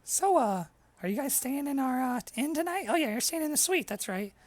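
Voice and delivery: high, chatty inn host voice; friendly innkeeper. Acted. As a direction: welcome guests as the innkeeper. GM plays innkeeper brother.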